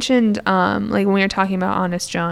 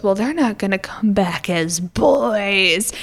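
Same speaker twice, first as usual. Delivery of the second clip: slow, voiceless menacing whisper; a devilish villain's slow hiss; sinister whisper; slower than usual. Retold or imitated. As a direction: lean in and whisper the dark secret. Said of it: "the coachman turns to them and goes".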